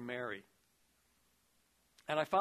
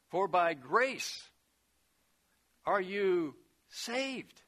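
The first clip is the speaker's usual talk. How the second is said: louder, slow "grace" and "saved"; slow emphasis; 2 semitones higher, 4 dB louder, much slower than usual. Real, not acted. Preacher quoting scripture to the congregation, slow and emphatic. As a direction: stretch each word out.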